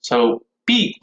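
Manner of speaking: ordinary talk, nothing marked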